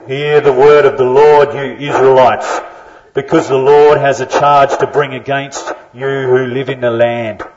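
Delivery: loud, booming prophet's proclamation; a loud, distant, amplified prophet's cry; proclaiming prophecy. Acted. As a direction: thunder it out like an Old Testament prophet. Reading prophecy with a prop.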